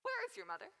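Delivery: sudden high-pitched question; abrupt question. Acted. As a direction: break off and ask it out of nowhere. Nurse abruptly changes subject.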